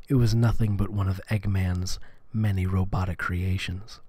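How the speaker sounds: hushed low narration. Acted. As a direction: read it like ASMR. ASMR fanfic reading.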